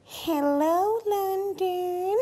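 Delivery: high, loud pop-star greeting to the crowd. Retold or imitated. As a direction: belt it out like a star on stage. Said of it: "[name] walked forward and went".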